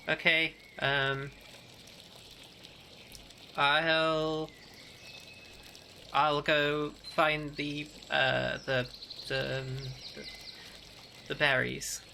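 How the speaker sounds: slow, halting, mildly nervous delivery; uneasy stammering. Acted. Frightened woman excusing herself from the snake, halting and uneasy. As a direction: stall and trail through each phrase, quietly uneasy. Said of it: "moving slightly more erratically now".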